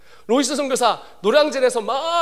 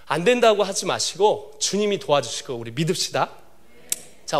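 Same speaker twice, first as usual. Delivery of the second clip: ordinary talk, nothing marked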